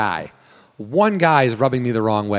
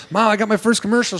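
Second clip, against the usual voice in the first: high, eager announcement; bursting to tell mom; excited news; 9 semitones higher than usual. Retold or imitated. Calling mom about his first commercial, high and excited. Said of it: "I was like".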